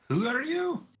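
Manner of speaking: surprised ask. Acted. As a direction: blurt it out in surprise. Player reacts in character.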